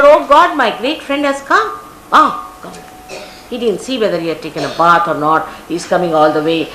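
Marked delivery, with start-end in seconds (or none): 0.0-2.7 joyful welcome. Acted. Krishna rushing out to greet his poor friend, overjoyed welcome. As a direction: throw the door open and greet him with delight.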